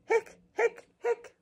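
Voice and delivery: high hiccup yelps; cartoon hiccup sounds; squeaky hiccups. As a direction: pop out high little hiccups. Skeleton's hiccups voiced in story.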